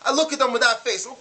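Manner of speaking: ordinary delivery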